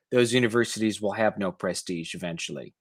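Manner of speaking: ordinary talk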